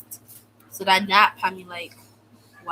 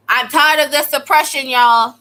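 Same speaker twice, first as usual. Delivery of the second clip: loud, higher, fed-up rant; loud complaint; 5 semitones higher, 9 dB louder than usual. Real, not acted. Telling viewers about detention, a loud fed-up rant. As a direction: belt out how sick of it you are.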